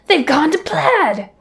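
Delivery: high, slow, drawn-out exclamation; movie quote voice. Retold or imitated. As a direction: stretch it out like a starship officer. Quoting Spaceballs hyperspeed line.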